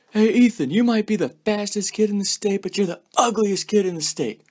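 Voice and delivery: high, loud heckle across the track; taunting shout. Retold or imitated. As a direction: yell it at the passing runner to mock him. Yelling taunt at runner.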